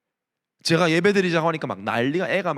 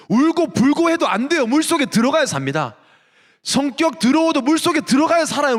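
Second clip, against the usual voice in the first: louder, higher preacher's cry; full-force preaching; 6 semitones higher, 3 dB louder than usual. Acted. Pastor exhorts the congregation, preaching at full force. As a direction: drive the point home from the pulpit.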